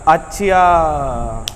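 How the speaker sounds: slow, stretched words of dawning understanding; drawn-out realization. Acted. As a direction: stretch it out as the point sinks in. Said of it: "जैसे खींच के बोलता है". (बात समझ आने पर खींचकर बोलना)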